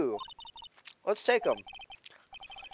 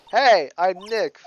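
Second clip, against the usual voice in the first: louder and slower greeting; 3 semitones higher, 7 dB louder, slower than usual. Shown as a demonstration. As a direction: say it loud and slow into the mic. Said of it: "saying it loud enough".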